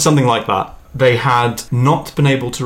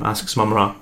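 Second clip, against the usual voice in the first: ordinary talk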